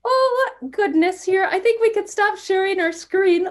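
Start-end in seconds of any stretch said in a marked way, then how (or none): none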